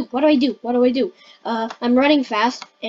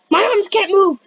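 higher-pitched, panicky cry; alarmed exclamation; 6 semitones higher, 4 dB louder than usual. Pretend. Player finding their arms stuck, alarmed exclamation. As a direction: raise the pitch in sudden alarm.

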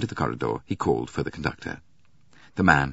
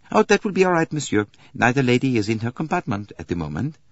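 higher-pitched, obliging conductor; 7 semitones higher, 4 dB louder than usual. Acted. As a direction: play a helpful conductor, voice raised. Conductor character replying.

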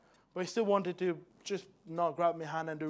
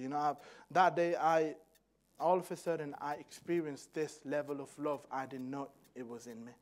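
normal talking voice

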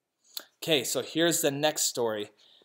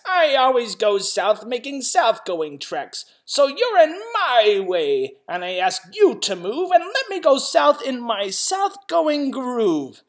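loud, higher, stubborn snap back; snapping retort; 8 semitones higher, 6 dB louder than usual. Acted. South-going Zax snaps back at the north-going one, loud and stubborn. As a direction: throw his words right back at him. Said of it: "snapped the south-going Zax".

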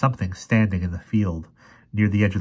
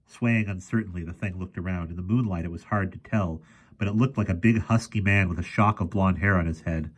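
usual speaking voice

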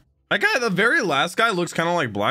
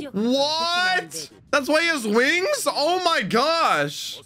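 high-pitched amazement, slowing at the end; astonished realisation; 12 semitones higher, slower than usual. Real, not acted. Viewer realising why he has wings, high astonished outburst. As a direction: cry out high as it suddenly clicks.

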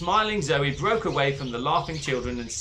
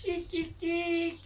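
high, soft squeaking rat; rat squeaks; 13 semitones higher, 7 dB quieter, slower than usual. Acted.

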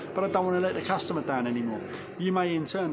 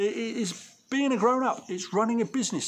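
usual speaking voice

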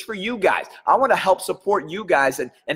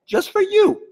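excited squeal; 4 dB louder than usual. Real, not acted. Excited emphasis to viewers.

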